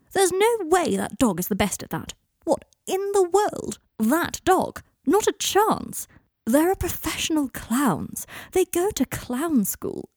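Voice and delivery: high, drawn-out incredulous rant; indignant disbelief. Acted. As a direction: protest in high, stretched-out outrage. Indignant rant about a dog poster.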